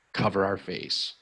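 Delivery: usual speaking voice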